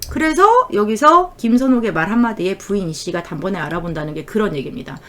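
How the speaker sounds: ordinary talk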